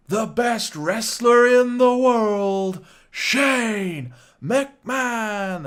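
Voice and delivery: loud, high, very slow drawn-out announcer call; booming wrestling ring announcer introduction; proper ring announcer intro. Retold or imitated. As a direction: stretch every word like a big arena intro. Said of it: "he does a proper Greg Hamilton, uh, intro".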